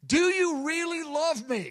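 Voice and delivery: high, pleading question to God; voicing Jeremiah's plea. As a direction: ask it like a hurt young prophet. Jeremiah's plea in retold prayer.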